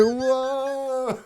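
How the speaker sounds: high, drawn-out whoa of wonder; awestruck. Retold or imitated. Tripping wizard-hatted guest walking around, awestruck. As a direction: marvel in a long, high coo.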